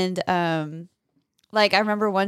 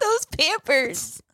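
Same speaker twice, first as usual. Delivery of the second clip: high, slow, swooning; high slow doting coo; mock gush; 8 semitones higher, much slower than usual. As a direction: coo over baby diapers, hammed up. Mocking tearful diaper-ad gush.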